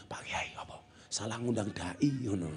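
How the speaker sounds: hushed, voiceless aside; mock whisper. Village head confides in the kiai beside him, acted whisper. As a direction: whisper it furtively to the person beside you. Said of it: "langsung bisik-bisik karo Pak Kiai sampingi".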